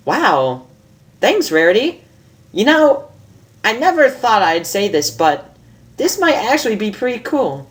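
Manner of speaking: a hint of a brash young mare; Rainbow Dash. Reads Rainbow's lines in voice.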